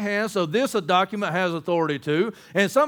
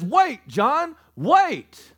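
raised, higher-pitched plea to wait; urgent halting call; 6 semitones higher, 3 dB louder, much slower than usual. Retold or imitated. Elder stopping the weeping apostle, urgent. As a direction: stop him with a high, firm call.

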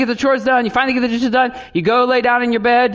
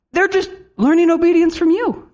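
laughing, higher; 9 semitones higher than usual. Real, not acted. Preacher laughing to parents, higher. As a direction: chuckle through the point.

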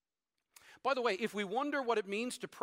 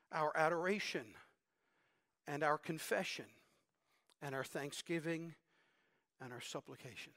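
fading to a hush; 6 semitones lower, 6 dB quieter than usual. Acted. Pastor lists kinds of prayer.

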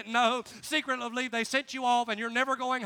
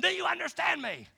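higher-pitched drill instructor's demand; mock yelling; 5 semitones higher than usual. Drill instructor suddenly challenging a recruit, mock yell. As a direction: imitate a yelling instructor without full volume. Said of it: "He'd be yelling and screaming".

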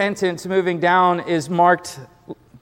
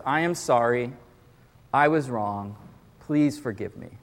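low, soft and humble, every word meant; mean each word of the apology; sincere apology; 4 semitones lower, 5 dB quieter than usual. Shown as a demonstration. Pastor modelling the nine words for the congregation, sincere and soft. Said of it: "here's the nine words".

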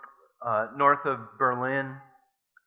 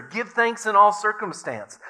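joyful repeat; 8 semitones higher, 6 dB louder than usual. A narrator's reading. Betsy seizing on the verse for Corrie, joyful and loud. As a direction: Give thanks in all circumstance said much higher and louder, all stressed.

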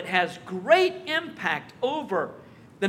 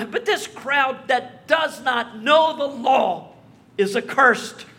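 slow, louder, sneering verdict; contemptuous condemnation; 5 semitones higher, 7 dB louder, slower than usual. Retold or imitated. Pharisees condemning the crowd, slow and sneering. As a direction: spit out the judgement slowly.

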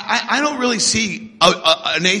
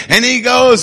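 ordinary talk, nothing marked

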